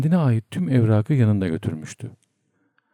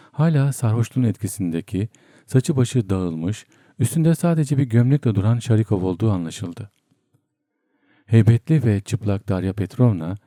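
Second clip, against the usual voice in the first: ordinary delivery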